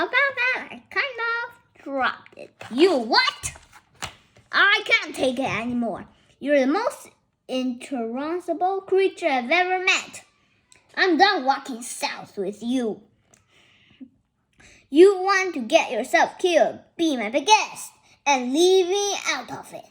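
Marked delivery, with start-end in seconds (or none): 2.7-3.5 loud rising you what; shocked disbelief. Acted. Hearing the food was dropped, shocked outburst. 4.5-19.8 loud fed-up rant; angry outburst. Acted. Fed up with companion, loud angry rant.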